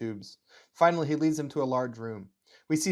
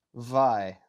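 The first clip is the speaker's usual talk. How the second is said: put-on German accent on one word; 3 dB louder than usual. Officer questioning the mother's purpose, German accent. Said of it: "my German accent slips a little into Russian".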